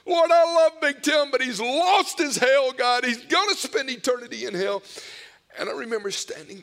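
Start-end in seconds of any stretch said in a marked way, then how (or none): 0.1-4.8 imitating a father's anguished, shouted plea; crying out. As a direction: shout the prayer high and loud, begging for your son. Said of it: "he started crying out on behalf of our family".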